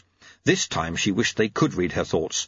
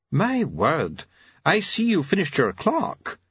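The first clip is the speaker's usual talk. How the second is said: high, admiring visitor; oblivious, cheery doctor; Doctor Hopkins; 8 semitones higher, slower than usual. Acted. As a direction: marvel pleasantly, pitched up.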